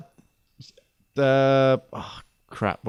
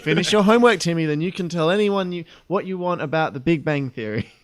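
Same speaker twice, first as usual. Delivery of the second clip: mock parent; 5 semitones higher than usual. Parent to five-year-old fan Timmy, mock scolding. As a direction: put on a hint of a scolding parent.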